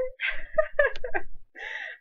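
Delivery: high laugh breaking into a cry; a loud squealing laugh-cry; laughing sob. Real, not acted. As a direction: laugh through tears. Laugh-cry after tearful quote.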